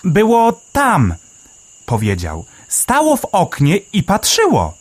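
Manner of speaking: Melek the sparrow. A narrator's reading. Sparrow Melek marvelling underground, high chirpy voice.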